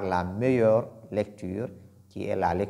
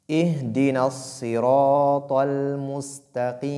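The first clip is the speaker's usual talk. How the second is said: Quran recitation; 3 semitones higher than usual. Shown as a demonstration. Teacher recites the verse for students. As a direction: intone the verse, stretching vowels. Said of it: "au milieu".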